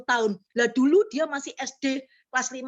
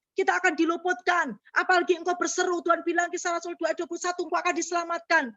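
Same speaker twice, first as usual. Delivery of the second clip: rally the congregation with a raised voice; preaching at full force; 8 semitones higher than usual. Acted. (khotbah berapi-api dengan suara tinggi)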